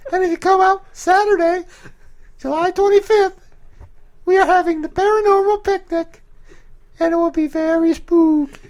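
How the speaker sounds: very high falsetto tour host; Will. Acted. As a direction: plug the event in a put-on falsetto. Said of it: "I can pretend to be Will".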